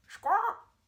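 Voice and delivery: piercing high, loud parrot squawk. A narrator's reading. Parrot squawks back at the monkey, very high and loud. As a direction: let out a shrill bird squawk. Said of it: "the parrot replied".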